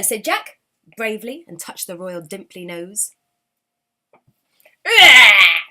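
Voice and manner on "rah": a sudden loud squawk of shock; startled king's loud cry; loud goblin king yelp. Acted. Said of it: "squeaked the king".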